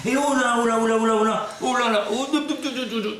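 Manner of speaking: high, loud panicked cries. Real, not acted. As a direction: yelp in alarm. Alarmed shouts in car.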